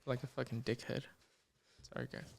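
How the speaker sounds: talking around chewing gum; chewing gum while talking; mouth full. Real, not acted. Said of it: "I have gum in my mouth".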